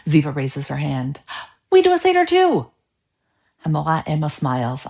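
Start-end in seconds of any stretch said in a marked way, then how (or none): 1.7-2.7 put-on child voice. A narrator's reading. Child voice in read story.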